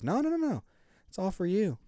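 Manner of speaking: high insistent. Retold or imitated. Retold generous refusal.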